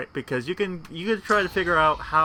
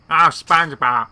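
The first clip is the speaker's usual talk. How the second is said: loud, exasperated Squidward groan; loud annoyed cartoon neighbour; Squidward impression; 2 semitones higher, 9 dB louder than usual. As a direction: whine it like fed-up Squidward. Mimics Squidward's exasperated line.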